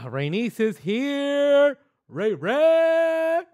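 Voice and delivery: high, loud, slow drawn-out welcome; excited greeting. Real, not acted. Greeting Rainice as she arrives on the show. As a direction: call out happily to a friend.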